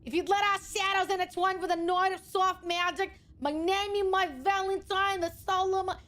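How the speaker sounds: hammed-up Boston romance; mock Boston accent reading romantic lines. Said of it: "Saying this with this accent is something else".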